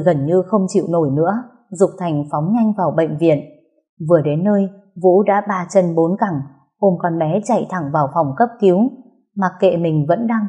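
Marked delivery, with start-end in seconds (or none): none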